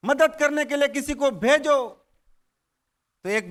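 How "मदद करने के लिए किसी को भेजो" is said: pleading call. Acted. Farmer begging God for help.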